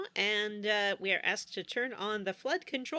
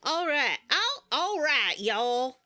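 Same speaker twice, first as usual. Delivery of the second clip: high, drawn-out mock Southern drawl; rally them in a hammed-up Southern twang; 5 semitones higher, 3 dB louder, slower than usual. Getting the podcast back on track, mock Southern. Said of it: "get all Southern".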